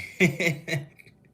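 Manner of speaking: sudden loud high laugh; big laugh. Real, not acted. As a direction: burst out laughing. Rires après sa blague.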